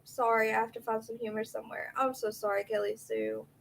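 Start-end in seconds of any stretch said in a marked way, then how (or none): none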